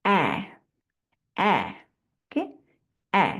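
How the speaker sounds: clipped short vowel অ্যা, mouth wide; short a sound demo. Shown as a demonstration. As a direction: say অ্যা short and open like in cat. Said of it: "আমি একটু মুখটা খেয়াল করো সবাই".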